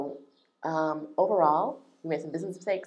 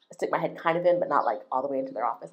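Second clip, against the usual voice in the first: ordinary talk, nothing marked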